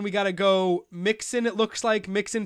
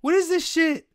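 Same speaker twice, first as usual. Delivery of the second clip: much higher, louder outburst on What is this shit?; angry yell; 9 semitones higher, 5 dB louder than usual. Real, not acted. Streamer yells at the auto-draft, furious and high. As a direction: blow up at the screen, pitch shooting up high.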